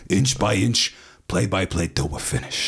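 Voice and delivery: intense Pacino speech voice; Al Pacino impression. Showing his range, Pacino pep-talk voice. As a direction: deliver it like a coach's rally. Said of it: "like Al Pacino's".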